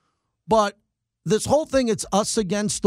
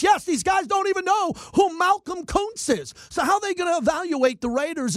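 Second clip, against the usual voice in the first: exasperated shout; 10 semitones higher than usual. Real, not acted. Radio host ranting about media.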